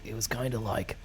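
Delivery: ordinary talk, nothing marked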